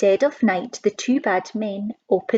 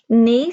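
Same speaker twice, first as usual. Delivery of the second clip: much louder horse neigh; 8 dB louder than usual. Acted. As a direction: call it out like a horse. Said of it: "said the horse".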